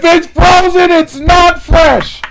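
slow, loud, rising to a high shout; exasperated retort. Retold or imitated. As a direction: act out arguing back, hammering each word. Retold argument with shouting customer.

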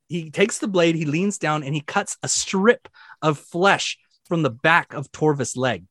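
ordinary talk, nothing marked

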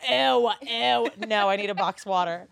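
put-on loud disgusted valley girl. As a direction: squeal it like a picky LA girl. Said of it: "trying to act like an L A girl".

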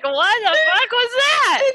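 high-pitched, slow, laughing incredulity; cracking up in mock shock; laughing disbelief. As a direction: squeal it through helpless laughter. Incredulous reaction while breaking up laughing.

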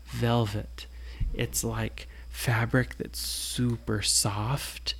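hushed, slow words for soft velvet; soft whisper. A narrator's reading. Parent telling a bedtime story, hushing softly. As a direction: breathe it out gently.

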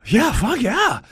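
very high, excited agreement; eager yes. Retold or imitated. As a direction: squeal your consent. Jumps at offer to sing.

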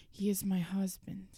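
secret whisper. Acted. Whispering to Ford that the car is her husband. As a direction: breathe it like a confession.

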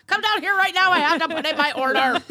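loud, high, laughing call; yelling across the room. Real, not acted. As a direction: holler it up the stairs through laughter. Calling daughter upstairs, laughing.

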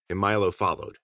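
ordinary delivery, nothing marked

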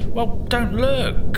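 high, slow, strained plea. Acted. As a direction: squeak it out embarrassed. Embarrassed plea while squatting.

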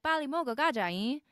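sing-songy Busan saturi. Retold or imitated. Daughter imitating how her mom talks, sing-songy Busan saturi. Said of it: "it's very sing-songy".

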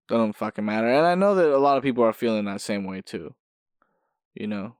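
usual speaking voice

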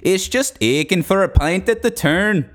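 high, hammed-up Irish brogue; mock Irish drinker; Irish accent. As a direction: play up the Irish heritage in a put-on lilt.

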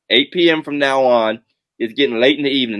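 ordinary talk, nothing marked

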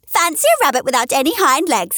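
high, scornful teasing; mock him openly; wild rabbit teasing. A narrator's reading. Wild rabbit teases the toy rabbit, high and scornful. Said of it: "began to laugh".

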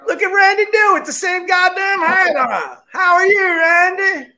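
big, rowdy hello stretched out long; hollered greeting. Real, not acted. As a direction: whoop out the guest's name in welcome. Hollered greeting to arriving guest.

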